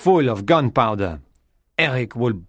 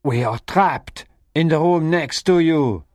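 raised-pitch urgent call; 7 semitones higher than usual. A narrator's reading. Raoul to Christine next door, calling urgently. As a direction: call through a wall. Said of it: "Raoul called".

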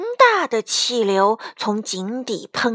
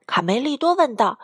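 ordinary delivery

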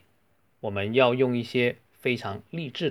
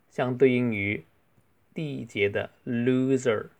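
ordinary talk, nothing marked

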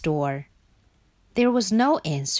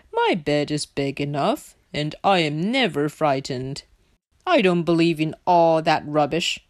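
low, firm Pig voice; confident Pig; 4 semitones lower than usual. Acted. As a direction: declare it low and unafraid. Pig boasts of being unafraid.

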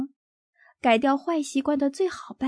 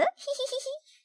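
high sneaky giggle; sly giggle; 8 dB quieter than usual. A narrator's reading. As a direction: giggle like a naughty kid. Cub giggles scheming.

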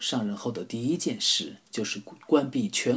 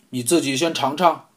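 louder, commanding president; stern demand; 4 semitones higher, 9 dB louder than usual. Acted. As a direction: order it bluntly. Suspicious president demands taste.

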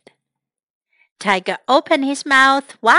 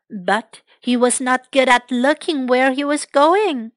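usual speaking voice

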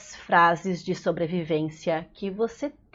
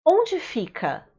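bright favorite phrase; 7 semitones higher than usual. Shown as a demonstration. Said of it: "a minha favorita é".

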